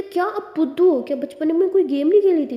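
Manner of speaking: put-on loud, high cheeky kid; little brother. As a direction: tease like an incredulous small boy. Little brother mocks her ignorance.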